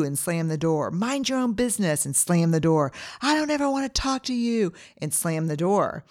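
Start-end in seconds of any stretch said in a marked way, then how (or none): 0.9-2.0 higher, half-acted scream; mild mock yell. Retelling ad couple screaming at each other, mild mock yell. 3.2-4.7 much higher, hammed-up yell; mock scream. Retelling ad couple fighting, higher mock yell.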